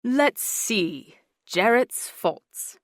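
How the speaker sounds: slow musing English lilt; English accent. A narrator's reading. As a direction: savour listing the faults, drawn out. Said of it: "English lilt".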